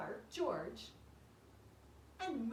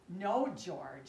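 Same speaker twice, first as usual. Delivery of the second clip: louder firm no; mother scolding; 5 semitones lower, 6 dB louder than usual. Acted. Mother telling George no, firm. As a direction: correct him like a mother. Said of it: "said George's mother".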